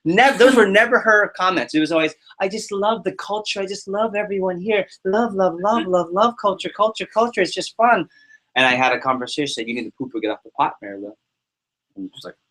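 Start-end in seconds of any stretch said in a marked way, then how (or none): 2.4-8.1 high-pitched imitation of endless gushing; gushing enthusiast. Mimicking what a colleague always said in debriefs, mock gushing. 9.7-11.2 low, quick and understated. Retold or imitated. Retelling a frank talk with a struggling lifer, low-key straight talk. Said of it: "I didn't say it offensively".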